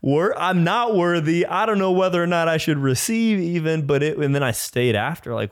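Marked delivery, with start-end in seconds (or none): 0.0-3.9 high, loud, slowed panicky blurt with a nervous laugh; panicky disbelief. Retold or imitated. Overwhelmed at his first real Mass, blurting in high panicky disbelief. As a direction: let it spill out half-laughing, high and rattled. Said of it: "like, freaking out".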